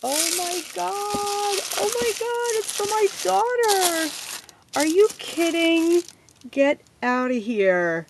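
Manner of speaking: thrilled high-pitched gushing; excited squeal. Real, not acted. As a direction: shriek with delight at the gift. Gift for her daughter.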